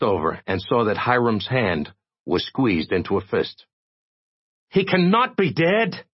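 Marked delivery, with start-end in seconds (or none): 4.7-6.0 high, insisting in furious denial; insistent. Acted. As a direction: refuse to accept it, pitch raised high. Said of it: "Hiram insisted".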